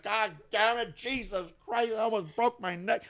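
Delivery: high, imitated grumble while getting up; grumbling. Imitating her getting up after the fall, high and grumbling. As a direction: groan it out, half laughing. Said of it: "fucking gets up and just like".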